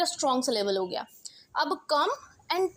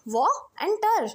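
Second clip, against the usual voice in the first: व and टर drawn out, higher, as separate chunks; split into syllables; 6 semitones higher, 4 dB louder, much slower than usual. Shown as a demonstration. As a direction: say व, then टर, slowly and apart. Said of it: "water को तोड़ दो".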